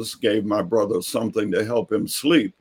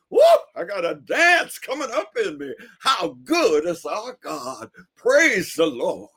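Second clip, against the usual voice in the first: ecstatic preacher; 10 semitones higher than usual. Retold or imitated. Neighbor dancing on the porch, high ecstatic praise. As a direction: let the dance come up.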